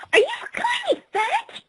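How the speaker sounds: high, shrill offended cry; offended high shout. Acted. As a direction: shriek it, insulted.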